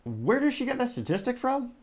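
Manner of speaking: can't believe what was just heard; stunned disbelief. Real, not acted. Host reacts to a wild statistic in stunned disbelief. Reacting to a startling TikTok claim.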